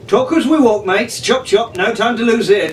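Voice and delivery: hammed-up ship's hand urging the mates on; brisk sailor. A narrator's reading. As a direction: Jack hurrying his mates through the jungle, high and brisk. Jack urging the group on.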